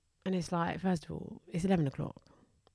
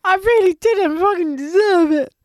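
indignant outburst; 10 semitones higher, 8 dB louder than usual. Real, not acted.

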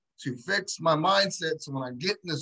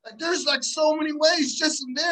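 amazed realization; 8 semitones higher than usual. Retold or imitated. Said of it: "I was like".

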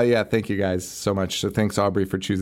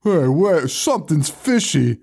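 raised-pitch clueless cop; mock suspicious cop; 4 semitones higher than usual. Retelling the cop finding cars in a lake, mock suspicion. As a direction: imitate a cop slowly smelling trouble. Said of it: "And is like".

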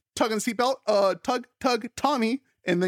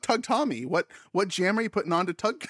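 ordinary talk, nothing marked

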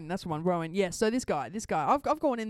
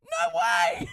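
mock disbelief; 5 dB louder than usual. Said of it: "And I'm like".

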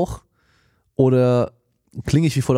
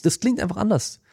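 head voice; 10 semitones higher than usual. Shown as a demonstration. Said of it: "mit der Stimme da oben drin so".

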